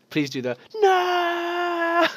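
slow, high, crying shout; anguished cry. Acted. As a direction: wail it in despair. Dramatic plea as robot falls.

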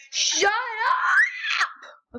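high shrieking "shut up"; yelling. Real, not acted. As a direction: scream it at your friend. Yelling at friend mid-game.

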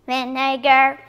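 normal talking voice

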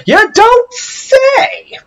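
very high, very slow mock amazement; sarcastic. Player mocks the obvious loading screen tip, sarcastic squeal.

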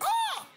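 very high, loud yelp; mock high-pitched ah of regret; shocked cry. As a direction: yelp like you just remembered. Mimicking regretful husband.

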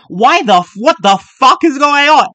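high, loud disbelieving shout; shocked yelling. Real, not acted. Shocked by the video.

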